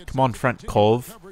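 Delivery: put-on slow, drawn-out Russian accent on "Front Cove"; playful mock Russian accent, slowed down. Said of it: "I imagine a Russian accent".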